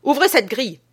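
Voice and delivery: high, loud order; commanding order. A narrator's reading. Man orders the guard to open the grille, high and loud. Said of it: "il m'ordonna".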